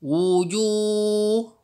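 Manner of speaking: Wujuh said clearly, recitation voice; recited syllables. Shown as a demonstration. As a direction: sound out Wujuh slowly for students. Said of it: "Hu dengan ya jadi hui".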